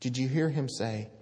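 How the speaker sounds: normal talking voice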